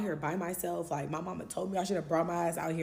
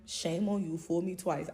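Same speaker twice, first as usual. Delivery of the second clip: ordinary talk, nothing marked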